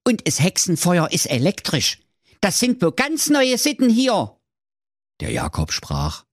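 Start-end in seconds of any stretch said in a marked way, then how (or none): 0.0-4.4 high, put-on grumpy old man voice; high nagging old-man rant; grumbling Wilhelm. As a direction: scold like a cranky fairy-tale boss. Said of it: "Der Wilhelm schimpfte".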